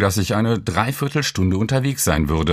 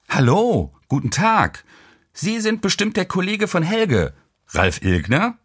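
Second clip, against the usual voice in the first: lightly voiced friendly hostess, higher; polite woman; 10 semitones higher than usual. A narrator's reading. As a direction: greet the visitor brightly as the course host.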